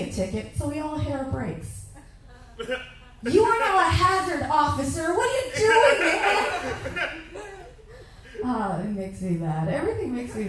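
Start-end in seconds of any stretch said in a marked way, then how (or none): 3.3-7.1 much higher, louder exasperated yelling; high, loud hammed-up road-rage shout. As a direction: scream at the cop through the windshield. Yelling at cop from car.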